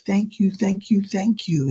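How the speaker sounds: high, emotional repeated thanks; tearful thanks, retold. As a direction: imitate a woman thanking through tears. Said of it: "she's crying".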